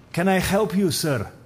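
put-on a Russian accent, high and loud; heavy Russian accent, calling out up the hall. Building supervisor calls out to the intruder in a Russian accent. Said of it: "Russian accent".

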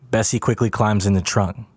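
ordinary delivery

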